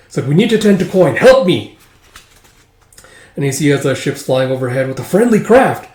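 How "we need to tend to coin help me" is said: high, loud call for help; rally a comrade urgently; urgent plea. Acted. Soldier urgently asks for help.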